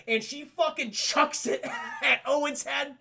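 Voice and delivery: high, laughing shout; real laugh while narrating. Real, not acted. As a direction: crack up telling the crutch throw. Laughing at crutch throw.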